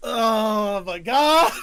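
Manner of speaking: loud, slow drawn-out cry; shocked. Real, not acted. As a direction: drag out the shock, loudly. Shocked by second game news.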